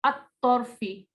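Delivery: At-torfi slow with a heavy rounded t; slow modelled word. Shown as a demonstration. Teacher modelling for student, slow careful At-torfi. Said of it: "repeat after me".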